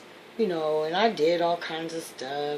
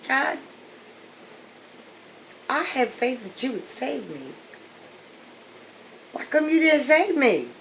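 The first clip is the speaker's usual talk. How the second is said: much higher, louder indignant question; aggrieved complaint; 9 semitones higher, 5 dB louder than usual. Acted. Dead man confronting God in heaven, indignant. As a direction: demand to know why you weren't saved.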